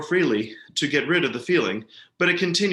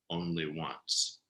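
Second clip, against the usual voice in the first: hushed; 8 semitones lower, 10 dB quieter, much slower than usual. A narrator's reading. Hushed after the old man's shriek.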